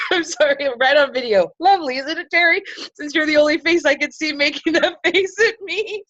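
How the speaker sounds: high-pitched, laughing through tears; tearful laughing. Real, not acted. Tearful, apologizing for sniffles on video.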